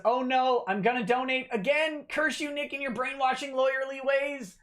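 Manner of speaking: high, hammy despairing cry; mock melodramatic villain. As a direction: ham up the curse like a cartoon villain. Reading a mock-dramatic donation.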